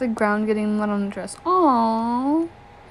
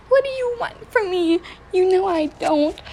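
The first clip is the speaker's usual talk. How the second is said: much higher and louder, pleading; desperate scream; 8 semitones higher, 4 dB louder than usual. Acted. Trapped girl screaming at her attacker in fear. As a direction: cry out in terror at him. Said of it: "Sobbing, I screamed at him".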